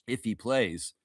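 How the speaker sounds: ordinary delivery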